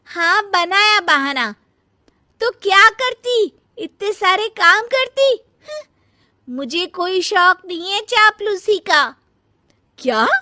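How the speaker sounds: high, loud and defiant; loud, high defiant shouting in a family quarrel; defiant angry retort. Acted. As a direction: throw the excuse back in her face. Younger one shouts back in quarrel.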